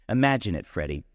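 usual speaking voice

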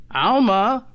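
high call with a rising end. A narrator's reading. Husband calling his wife from the bedroom, calling out. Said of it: "he called".

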